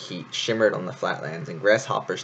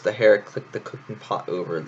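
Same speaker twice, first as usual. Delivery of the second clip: plain reading voice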